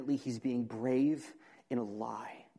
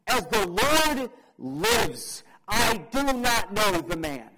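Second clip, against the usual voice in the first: loud, raised, solemn oath; Peter swearing an oath; 7 semitones higher, 11 dB louder, slower than usual. Acted. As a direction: swear it forcefully, word by slow word. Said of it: "Peter's oath might have been something like this".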